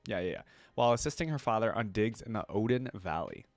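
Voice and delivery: ordinary talk, nothing marked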